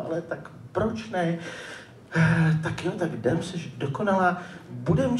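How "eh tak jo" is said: ordinary talk, nothing marked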